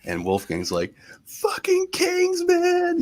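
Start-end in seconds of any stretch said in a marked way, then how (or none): 1.2-3.0 high, drawn-out excited holler; excited fan. Retold or imitated. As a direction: imitate an overexcited hockey fan. Acting out hockey-obsessed Wolfgang.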